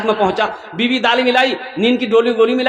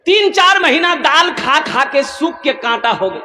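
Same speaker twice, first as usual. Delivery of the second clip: normal talking voice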